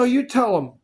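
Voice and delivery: sharp retort. Retold or imitated. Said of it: "he goes".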